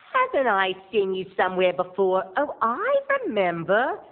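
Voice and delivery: mock-sweet sarcastic voice, drawn out at the end. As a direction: fake friendliness dripping with sarcasm. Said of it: "she gets real sarcastic".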